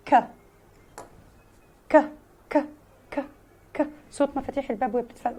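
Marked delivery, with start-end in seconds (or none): 0.1-3.9 ك as a short breathy k click; teacher drilling the k sound; k sound demo. Shown as a demonstration. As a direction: snap out a dry k. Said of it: "ال K بيتنطق".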